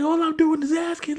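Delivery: high-pitched imitated protest; mock protest. Acts out quarrel with child.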